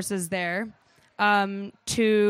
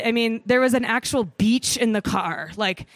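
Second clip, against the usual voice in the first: usual speaking voice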